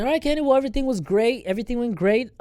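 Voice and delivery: high, bright nurse reassurance; cheery nurse voice. Retold or imitated. Nurse telling the woken patient it went fine, chirpy and high. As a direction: beam like a nurse with good news. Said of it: "She's like".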